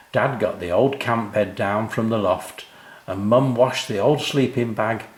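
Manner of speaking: ordinary delivery, nothing marked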